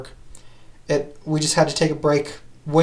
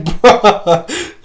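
higher, louder bruh through a laugh; 6 semitones higher, 7 dB louder than usual. Retold or imitated. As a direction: laugh out the word in disbelief. Retelling his reaction, laughing.

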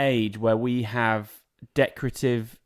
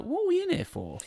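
pitched up, baffled; high confused; 14 semitones higher, 6 dB quieter, faster than usual. Retold or imitated. Retold confused reply.

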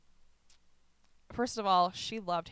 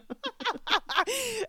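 high laugh; 8 dB louder than usual. Real, not acted. High loud laugh at her own retold reply.

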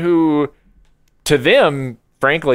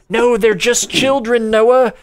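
self-calming; 6 semitones higher than usual. Retold or imitated. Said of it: "And then I'm just like".

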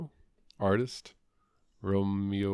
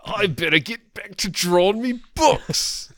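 slow, loud, much higher Cockney; mock Cockney accent; 12 semitones higher, 10 dB louder, slower than usual. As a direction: drag out a silly Cockney accent. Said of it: "if he talks like that".

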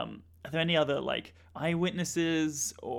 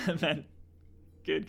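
normal talking voice

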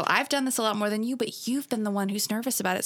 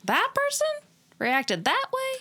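surprised; 3 dB louder, much slower than usual. Retold or imitated. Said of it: "you were like".

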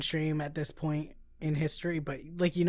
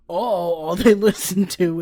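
louder, higher mock complaint; mock exclamation; 5 semitones higher, 13 dB louder than usual. Host mocking clichéd metal movies, mockingly. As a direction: laugh through a loud mocking line.

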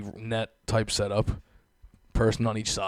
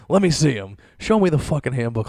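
louder, higher outraged demand; indignant demand; 4 semitones higher, 7 dB louder than usual. Acted. Customer demanding the employee handbook, indignant. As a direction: demand proof, loud and worked up. Acted demand to see the rules.

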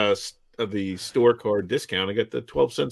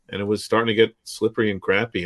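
usual speaking voice

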